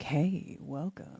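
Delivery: low, soft voice-over greeting; smooth voice actor. Acted. Imagining a hired voicemail voice, smooth voice-actor impression. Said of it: "let's talk about this voice actor".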